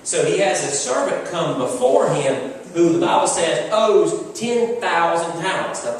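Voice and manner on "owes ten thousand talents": owes ten thousand talents drawn out slow; slow emphasis. Acted. Preacher stressing the servant's debt, slow and weighty. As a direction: stretch each word to show the size.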